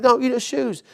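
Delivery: high put-on kid voice, laid back; imitating a teen. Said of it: "It's like".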